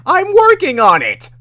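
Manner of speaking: very high, loud comeback; exasperated scream. Acted. Streamer playing themself arguing with Dandy, exasperated high scream. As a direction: scream back in mock desperation. Acts out own reply to Dandy.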